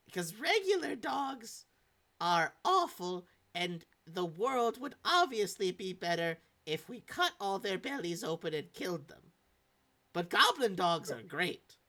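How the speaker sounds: high, loud, emphatic opinionated character; Sindel. Acted. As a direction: pitch it up and stretch the key words. Game master voices Sindel.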